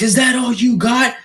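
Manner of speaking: high, strained bloodied-tough-guy taunt; mock taunt. Bloodied fighter taunting his opponent, mock taunt. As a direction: imitate a beaten guy taunting. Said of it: "He's like".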